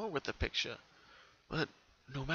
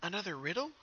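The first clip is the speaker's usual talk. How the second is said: asking aloud; 6 semitones higher, 3 dB quieter than usual. Acted. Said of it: "Pinkie asked aloud to no one".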